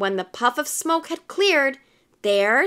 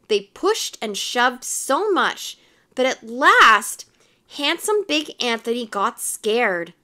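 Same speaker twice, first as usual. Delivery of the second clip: usual speaking voice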